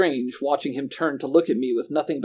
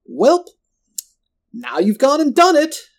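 very high, loud holler; scolding villain yell, pitched way up; Roland hollering; 13 semitones higher, 8 dB louder than usual. A narrator's reading. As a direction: holler it after someone getting away. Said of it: "Roland hollered after me".

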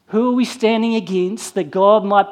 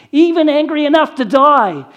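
higher and louder, hot retort; angry retort; 6 semitones higher, 6 dB louder than usual. Acted. Jonah answering God, a loud angry retort. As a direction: snap back in defiance. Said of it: "Jonah retorted".

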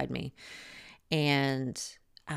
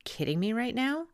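incredulous; 9 semitones higher than usual. Retold or imitated. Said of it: "I'm like".

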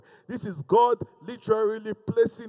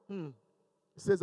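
ordinary delivery

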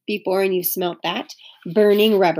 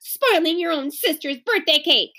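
angry; 7 semitones higher than usual. A narrator's reading. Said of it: "said Beezus angrily".

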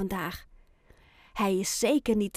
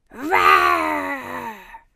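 louder growling roar; 7 dB louder than usual. A narrator's reading. The roaring child growls again, louder this time. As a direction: roar it out hard. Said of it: "een harde grom".

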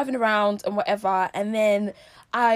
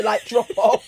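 giggling through it; laughing. Real, not acted. Laughing joke.

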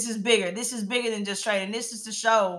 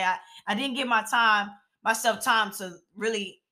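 ordinary talk, nothing marked

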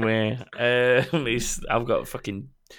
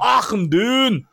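high, loud, stretched Scottish cry; Scottish dwarf accent; 12 semitones higher, 5 dB louder, slower than usual. Acted. Explaining the dungeon joke to James, Scottish dwarf bellow. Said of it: "So he's like".

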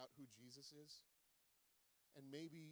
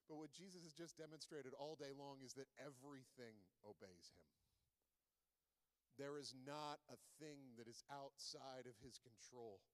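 usual speaking voice

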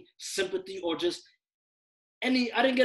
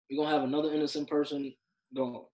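usual speaking voice

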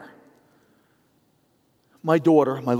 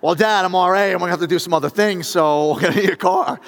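higher, louder impersonation of a daughter; daughter wheedling for car; 4 semitones higher, 8 dB louder than usual. Retold or imitated. Daughter pitching Dad for a car, higher imitation. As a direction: play the daughter making her pitch.

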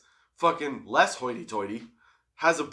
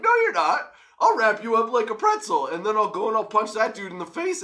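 cocky threat; 6 semitones higher than usual. Retold or imitated. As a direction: brag it out like a sure thing. Retold confident threat to challenger.

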